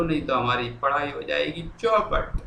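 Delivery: ordinary talk, nothing marked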